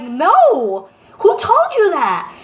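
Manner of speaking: loud, high, disbelieving retort; indignant denial. Retold or imitated. Mom questioning son about Santa, indignant. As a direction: demand it in mock outrage.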